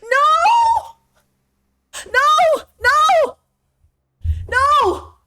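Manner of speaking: high, loud despairing No; anguished shouts. Real, not acted. Streamer shouting at the game in despair, loud.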